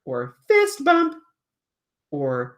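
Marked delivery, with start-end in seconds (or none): 0.5-1.2 very high, loud puppet voice; puppet's encouraging voice. Acted. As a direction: squeal it sweetly high like a puppet. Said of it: "she'll go like".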